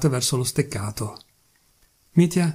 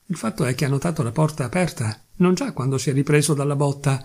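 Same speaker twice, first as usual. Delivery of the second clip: ordinary delivery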